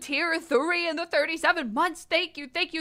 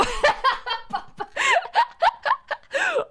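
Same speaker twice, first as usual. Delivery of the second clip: high-pitched cackling laughter; 14 semitones higher than usual. Real, not acted. Laughing at own joke.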